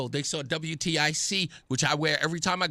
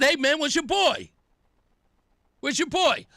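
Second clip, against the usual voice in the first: mock challenge; 7 semitones higher than usual. Host calling out, challenging the others.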